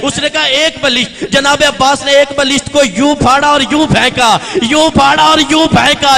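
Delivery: loud, high, rising sermon recitation; full-force preaching. Acted. Preacher building the Abbas story to a roar, loud and high. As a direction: preach it out to the whole gathering.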